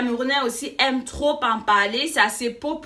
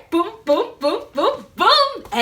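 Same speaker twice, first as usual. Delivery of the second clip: rising sung poum poum beats, getting higher; a jingle between words; playful poum sounds; 8 semitones higher, slower than usual. Pretend. Presenter hums a bouncy transition, rising high.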